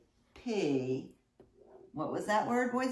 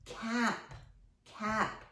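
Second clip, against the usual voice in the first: normal talking voice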